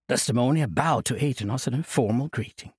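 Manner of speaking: ordinary delivery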